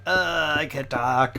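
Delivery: slow, strained croak of a sick throat; mock lost voice. Streamer retelling sore throat to viewers, mock croaking. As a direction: rasp it out like your voice is gone. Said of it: "and it was like".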